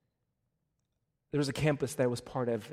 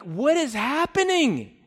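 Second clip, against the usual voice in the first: high, loud, drawn-out exclamation; retold bewildered cry; 10 semitones higher, 9 dB louder, slower than usual. Youth pastor facing a yelling kid, retold bewildered cry. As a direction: cry it out slowly in mock alarm.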